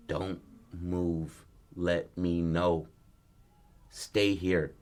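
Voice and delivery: slow, low-key retold warning; spaced-out, quiet orders; stern warning. Father warning his son at the diner, slow and stern.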